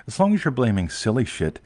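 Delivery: ordinary talk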